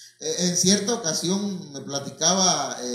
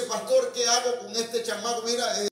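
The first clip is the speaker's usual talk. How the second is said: higher, worried parent voice; imitated pleading; 5 semitones higher than usual. Parent begging the pastor to help the child, pleading. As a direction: plead as an alarmed parent, acted.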